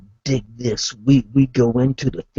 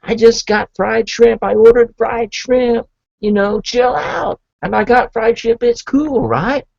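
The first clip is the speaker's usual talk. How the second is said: insistent denial; 9 semitones higher, 4 dB louder than usual. Retold or imitated. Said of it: "I said".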